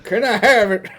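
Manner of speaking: high, loud prospector through laughter; hammed-up old prospector, cracking up. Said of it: "You have to say it like an old prospector".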